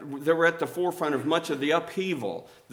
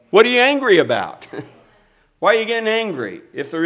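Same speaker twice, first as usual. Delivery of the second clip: challenging preacher shout; 4 semitones higher, 6 dB louder, faster than usual. Acted. Preacher challenges them, raised.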